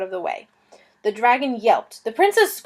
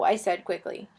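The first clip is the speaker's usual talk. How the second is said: ordinary delivery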